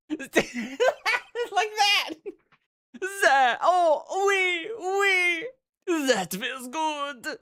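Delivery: high, giggling, swooning chef; breathy French chef pleasure. Acted.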